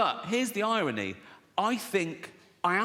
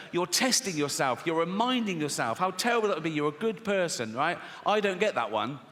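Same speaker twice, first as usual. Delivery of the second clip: normal talking voice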